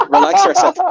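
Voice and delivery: high squeaky mocking "relax yourself". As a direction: squeak it out like a mock. Mocking the friend.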